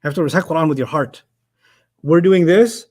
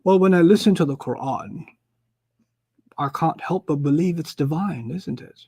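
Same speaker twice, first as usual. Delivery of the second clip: imitated posh British professor, trailing softer; posh British accent; 4 dB quieter than usual. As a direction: put on a posh British accent, fading at the end. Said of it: "in his posh British accent".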